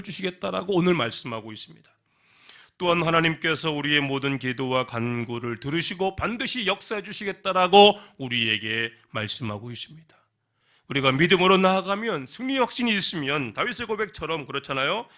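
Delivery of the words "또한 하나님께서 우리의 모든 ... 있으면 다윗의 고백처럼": rising, louder sermon build; preaching at full force. Real, not acted. Preacher proclaiming God's promise to the congregation, building louder. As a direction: build the blessing to a climax.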